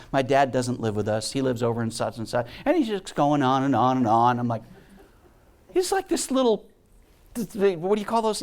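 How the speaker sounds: ordinary delivery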